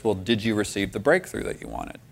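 soft, gentle divine question. Retold or imitated. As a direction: ask it softly, without any pressure. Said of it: "speaks to me very gently".

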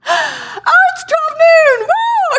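real delight at the clock; excited cheer. Real, not acted. Noon surprise, cheering.

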